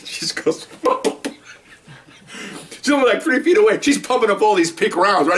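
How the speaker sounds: telling it through laughter; helpless amusement breaking into the words; real laughing. Real, not acted. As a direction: crack up, then push on in a high voice. Breaks up retelling the shooting.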